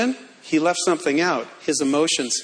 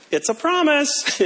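accidental squeaky voice; 8 semitones higher than usual. Real, not acted. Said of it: "that sounded like Pee Wee Herman".

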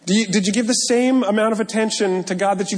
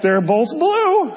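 higher, slow, lightly voiced wife; a hint of the unimpressed wife, drawn out; wife's deadpan reply; 8 semitones higher, 3 dB louder, slower than usual. Wife answering the pastor's outfit, lightly voiced and slow. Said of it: "And she's like".

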